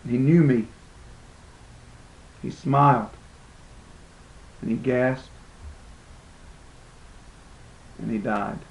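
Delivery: soft, low, fading with long pauses; hushed grief. Acted. Friend dies before him.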